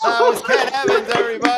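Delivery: high, loud show-host outro; emcee applause call. Real, not acted. Host closing a comic's set, rousing and loud.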